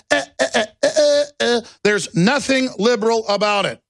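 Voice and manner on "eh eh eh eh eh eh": high mocking eh-eh squeaks. Mocking Google with high squeaky eh sounds. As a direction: bleat it high.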